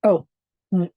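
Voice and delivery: ordinary delivery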